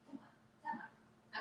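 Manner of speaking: softly whispered come on; breathy whispered urging to the puppy; whispered coaxing. Real, not acted. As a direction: coax the dog in a whisper. Coaxing dog quietly.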